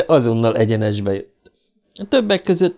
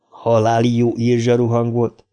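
plain reading voice

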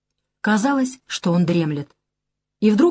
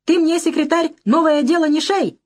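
a high, frantic cry from a cornered man; hysterical cry; 9 semitones higher than usual. A narrator's reading. Churin shouts hysterically at the party secretary. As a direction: yell it in panic, voice jumping high. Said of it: "истерически крикнул".

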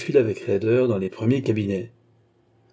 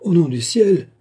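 louder, higher imploring cry; pleading; 7 semitones higher, 7 dB louder than usual. Acted. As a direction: beg him not to, raised voice. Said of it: "fit Ducorneau suppliant".

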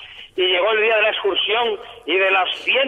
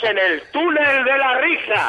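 slow, higher, booming fake echo; 7 semitones higher, slower than usual. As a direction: announce it like an echoing tunnel. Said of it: "¿cómo te viste el eco".

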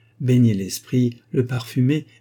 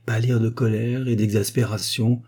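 plain reading voice

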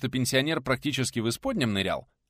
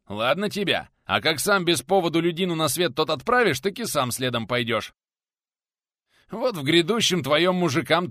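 loud, raised, insistent tirade; heated rebuttal; 6 semitones higher, 7 dB louder than usual. A narrator's reading. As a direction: argue back forcefully, piling up the points. Heated lecture in a quarrel.

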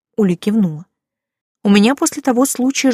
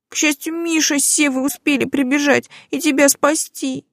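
put-on high, tearful girl's voice, pleading; a crying mermaid girl apologising; tearful young girl; 8 semitones higher than usual. Уля speaks, crying. (плачущая девушка, высокий голос)

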